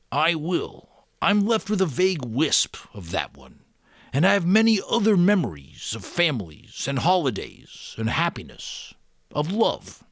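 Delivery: the Giver. A narrator's reading. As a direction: speak as the kindly old Giver. Giver voiced in read story.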